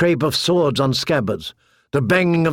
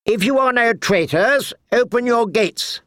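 higher, haughty knight calling up; 9 semitones higher than usual. A narrator's reading. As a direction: call up to the wall commandingly. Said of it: "Sir Amory called".

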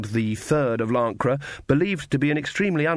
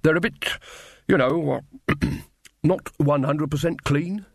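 awkward stop-start with a throat clear; hesitant corporal; 3 semitones higher than usual. Acted. Corporal wilting under Granny's stare, awkwardly hesitant. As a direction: pick the words gingerly, stalling.

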